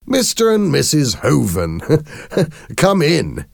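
high-pitched greeting broken by giggles; still laughing. A narrator's reading. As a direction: welcome the guests while failing to hold back laughter. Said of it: "Simon started to laugh louder".

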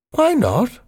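put-on high, genteel old lady voice; the Queen. The Queen offering her saddle to Spiggy, high genteel voice.

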